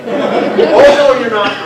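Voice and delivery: high, loud mock protest; loud insistent lady. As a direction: overrule him, high and loud.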